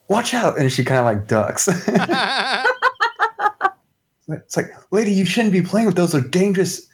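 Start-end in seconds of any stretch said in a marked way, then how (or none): 0.1-0.6 mock alarm. Character warns as camera clicks. 4.9-6.9 higher, fast worried warning; alarmed country girl. Acted. Character warns old lady.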